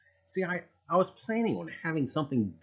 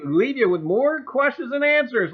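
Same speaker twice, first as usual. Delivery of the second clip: show tagline; 11 semitones higher, 10 dB louder than usual. Acted.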